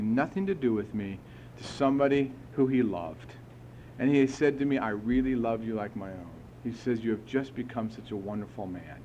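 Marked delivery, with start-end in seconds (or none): 4.8-9.0 softer, gentle sponsor voice; retold loving sponsor; tender. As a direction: say it with quiet affection.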